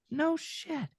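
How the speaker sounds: high, soft mock amazement; retold amazement. Newcomer reacting to how dubbing works, softly amazed. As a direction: act out hushed, high-pitched wonder.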